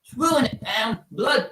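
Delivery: high, far-off mock British "Ruining it" with the t dropped; "Ruining it" sung up high like a Brit; mock British accent. Shown as a demonstration. Streamer mocking British slang, high mock British accent. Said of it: "British slang and all that".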